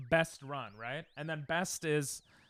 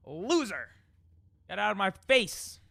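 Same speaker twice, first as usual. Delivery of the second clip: loud, high mocking taunt; gloating yell; 6 semitones higher, 7 dB louder than usual. Real, not acted. Streamer taunting the game after a win, loud gloating yell. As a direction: jeer it out loud.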